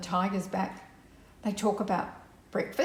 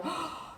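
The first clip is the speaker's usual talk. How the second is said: quick gasp cutting off a sentence; soft sharp intake of breath; startled gasp; 8 semitones higher, 9 dB quieter than usual. Acted. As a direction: gasp as if something just whizzed past. Nora interrupted mid-sentence in story.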